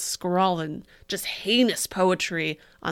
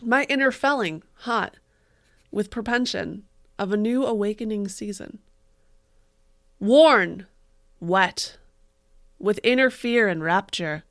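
mock dramatic poetry reading; 8 dB louder than usual. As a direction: ham up the tragic verse.

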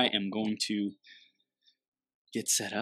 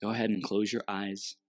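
guided meditation. Acted.

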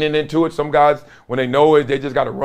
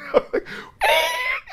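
very high breathless cackle; helpless high laugh; high squealing laugh; 4 dB quieter than usual. Real, not acted. Laughing at the memory.

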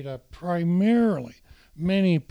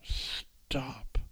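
hushing, trailing to a whisper; calm him down gently; soft stop; 6 dB quieter than usual. Retold or imitated. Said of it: "So she went".

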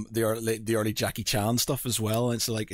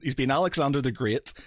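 usual speaking voice

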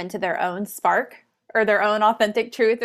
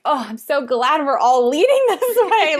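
sarcastic mock gladness; 6 semitones higher, 6 dB louder than usual.

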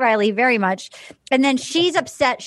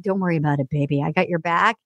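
mock smooth guy; 6 semitones lower, faster than usual. Mimicking Dale comforting her.

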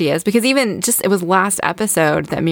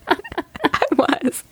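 laughing; 11 semitones higher, 3 dB quieter than usual. Real, not acted. Laughing after nerd moment.

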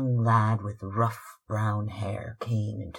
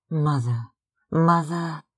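high, loud cry of "Mother"; 5 semitones higher, 6 dB louder than usual. Acted. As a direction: call out to her mother, full voice. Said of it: "she cried".

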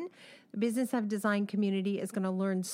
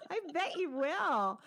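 laughing while saying it; 9 semitones higher, slower than usual. Real, not acted. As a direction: let the words ride on a real laugh. Laughing reply to host's joke.

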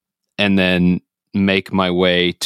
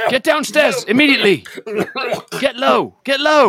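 high-pitched shouted orders; urgent yell. Acted. Filner orders companion out of smoke, urgent yell. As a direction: shout commands through a fire. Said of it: "Filner will yell".

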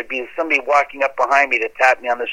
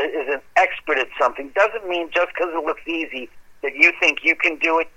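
ordinary delivery

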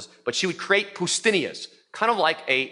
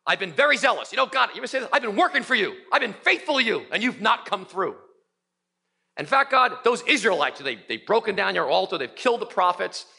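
higher, whining complaint to God; complaining Elijah; 4 semitones higher than usual. Acted. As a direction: play Elijah griping that nobody appreciates him.

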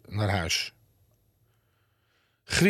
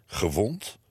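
lower, puzzled one-word question; questioning echo; 3 semitones lower than usual. A narrator's reading. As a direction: echo it back, taken aback. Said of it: "vroegen, eh, meneer en mevrouw Lomans". (verbaasd, vragend herhaald)

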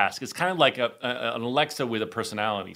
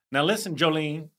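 Southern sass climbing high and loud; 10 semitones higher, 4 dB louder than usual. Retold or imitated. Retelling the cycling instructor's Jolene riff, sassy Southern imitation. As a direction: imitate a sassy Southern diva, building into laughter.

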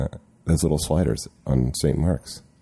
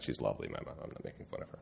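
ordinary delivery

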